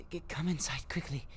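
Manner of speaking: hushed and hurried; urgent whisper. Acted. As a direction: whisper it, quick and tense. Hurrying him in secretly.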